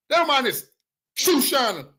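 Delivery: usual speaking voice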